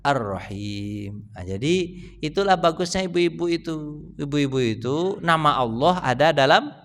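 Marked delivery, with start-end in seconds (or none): 0.0-1.3 Ar-Rahim stretched very slow, each syllable held; drawn-out name. Shown as a demonstration. Preacher answering his own question to the men, Ar-Rahim drawn out. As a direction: lean on Ar-Rahim and hold it long. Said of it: "namanya apa?".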